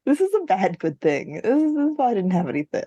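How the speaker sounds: normal talking voice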